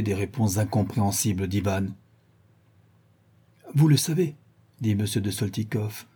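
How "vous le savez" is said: higher, mildly surprised question; a touch of puzzlement, pitched up; slightly surprised. A narrator's reading. Count puzzled by the young man's answer, slightly surprised. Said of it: "un peu surpris".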